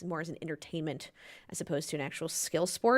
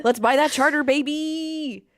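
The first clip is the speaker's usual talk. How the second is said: loud, high, laughing whoop; gleeful celebration; 10 semitones higher, 9 dB louder, much slower than usual. Real, not acted. Co-host cheering the host's loan news, loud and laughing. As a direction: cheer it like you just won big.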